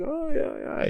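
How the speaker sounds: squeaky high oh yeah yeah; brushing it off in a high strained voice; fake casual reply. Answering his student while bleeding, in a high strained voice. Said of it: "I'm like".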